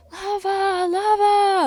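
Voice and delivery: 1 calling out. Retold or imitated. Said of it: "I'm like".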